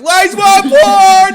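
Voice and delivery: huge, high, drawn-out shout; slow, full-volume embarrassing bellow; yelling. Retold or imitated. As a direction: imitate a friend hollering across a restaurant. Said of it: "Fucking yelling".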